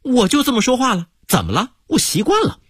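a touch of sullen defiance; sulky. Acted. Waiter answers the American guest back, sulky. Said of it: "服务员一脸不高兴".

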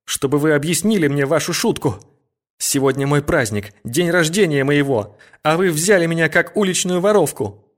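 high, impassioned demand; desperate fervor. A narrator's reading. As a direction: plead in despair, voice rising. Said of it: "со всем пылом отчаяния".

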